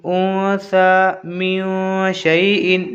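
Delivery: "ऊ सा मिन शै इन" slowly, nasal hum on "ऊ" and "मिन"; ikhfa nasal hold. Shown as a demonstration. Teacher reads ikhfa before sheen, nasal hold. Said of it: "यहाँ पर भी हुर्फ़ इख्फ़ा में से शीन".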